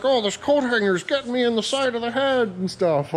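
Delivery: high-pitched mock complaint, imitating a buddy; imitating a buddy's complaint. Retelling buddy's coat hanger mishap, high imitated complaint. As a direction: mimic your buddy griping, pitched well up. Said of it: "he's like".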